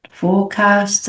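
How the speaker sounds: ordinary talk, nothing marked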